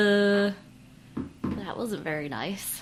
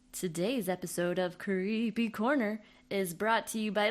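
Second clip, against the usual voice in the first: spoof ad read; 7 dB louder than usual. Acted.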